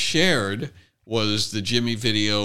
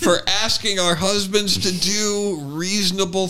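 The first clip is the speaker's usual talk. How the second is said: mock aggrieved wife; 9 semitones higher, 4 dB louder than usual. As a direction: drag it out like a martyr. Mocking validated wives.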